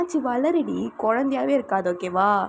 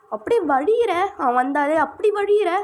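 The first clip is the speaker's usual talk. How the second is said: high, loud sing-song mockery of her flirting; mocking imitation; 7 semitones higher, 4 dB louder than usual.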